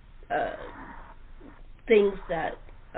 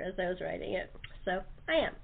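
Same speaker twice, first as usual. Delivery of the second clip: normal talking voice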